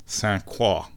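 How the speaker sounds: Croix said the French way, as kwa; French Saint Croix. Shown as a demonstration. Viewer hears her say Saint Croix in French, kwa. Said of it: "I wanna say it in French".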